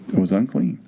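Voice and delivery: ordinary delivery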